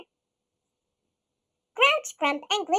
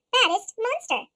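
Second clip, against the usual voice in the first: usual speaking voice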